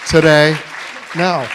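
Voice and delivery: loud, bright cheer; excited echo. Real, not acted. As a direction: call it out to the room with delight. Host echoes the answer with enthusiasm.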